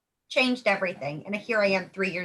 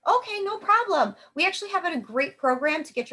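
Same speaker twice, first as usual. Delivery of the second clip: reassuring reply; 7 semitones higher than usual. Shown as a demonstration. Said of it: "They'll usually say".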